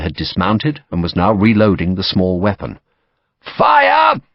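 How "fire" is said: high, full-voiced command shout; shouted order. A narrator's reading. Lieutenant commanding his company, shouting the volley order. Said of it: "the lieutenant ... shouted".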